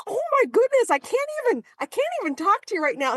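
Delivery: imitating a friend's high-pitched, can't-even exasperation; exasperated outburst. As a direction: throw up your arms and squeal in disbelief. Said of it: "throws up their arms".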